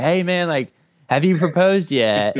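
high, eager blurting question; tactless blurt. Retold or imitated. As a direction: blurt it out without thinking. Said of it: "and I was like".

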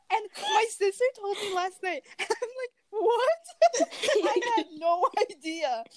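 high-pitched, laughing disbelief; laughing through the story. Real, not acted. Retelling shocking discovery.

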